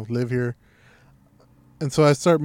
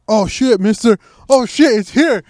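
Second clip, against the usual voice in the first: high, loud excited yelp; alarmed discovery; 5 semitones higher, 8 dB louder than usual. Retold or imitated. Roommate spots the rat behind the cabinets, excited alarm. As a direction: call out the sighting in a rush of alarm.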